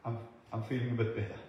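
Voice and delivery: low, soft, hesitant admission; small, subdued, halting voice; tentative retold reply. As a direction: imitate someone cautiously feeling a little better. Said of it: "she said".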